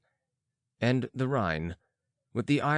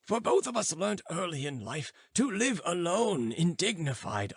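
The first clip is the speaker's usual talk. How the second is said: put-on stern old philosopher; 7 semitones higher than usual. Philosopher scolding the students for firing pistols. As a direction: rebuke them like a cranky old master. Philosopher lectures his friend.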